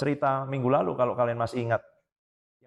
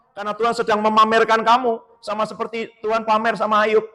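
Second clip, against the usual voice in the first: emphatic preaching; 8 semitones higher, 8 dB louder than usual. Acted. Preaching at full force.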